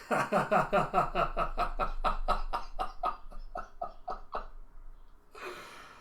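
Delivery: long mock-evil cackle; hammed-up villain laugh. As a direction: cackle in a rapid string, trailing off into breath. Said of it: "she just starts laughing towards us like".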